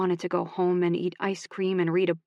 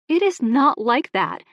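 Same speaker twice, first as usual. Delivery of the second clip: higher, louder and slow; defensive plea; 7 semitones higher, 7 dB louder than usual. Acted. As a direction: insist it isn't so. Emmy denies, defensive.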